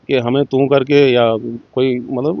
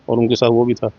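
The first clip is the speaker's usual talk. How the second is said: ordinary talk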